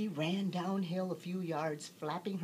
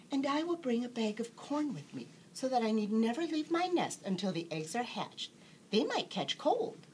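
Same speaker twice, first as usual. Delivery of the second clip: higher, earnest little duck voice, rising on catch cold; fussy, careful mother duck, pitched up; conscientious duck; 7 semitones higher than usual. A narrator's reading. Jemima telling the fox her nesting plans, higher and earnest. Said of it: "said the conscientious Jemima".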